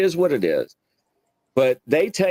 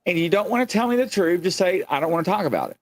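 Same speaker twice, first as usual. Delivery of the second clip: lecturing tone; 7 semitones higher, faster than usual. Retold or imitated. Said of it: "I was like".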